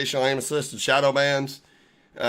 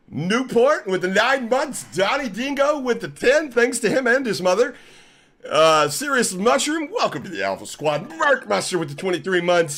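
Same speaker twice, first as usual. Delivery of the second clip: announcer shout-outs; 7 semitones higher, 4 dB louder than usual. Acted. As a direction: call out each name like an announcer. Streamer announces subscriber shout-outs.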